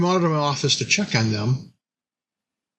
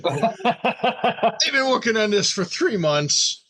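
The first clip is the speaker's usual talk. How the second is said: much higher, drawn-out, slow mock outrage; incredulous disbelief; 9 semitones higher, slower than usual. Telling his wife her hope is crazy, slow high disbelief. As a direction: stretch it out like she's lost her mind.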